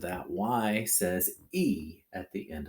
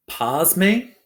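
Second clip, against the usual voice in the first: normal talking voice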